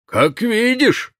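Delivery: dry amused reply; smirking. Acted. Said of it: "усмехнулся".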